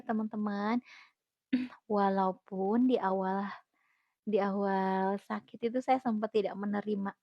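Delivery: ordinary talk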